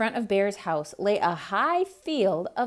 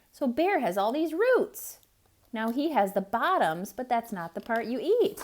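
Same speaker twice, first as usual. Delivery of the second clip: usual speaking voice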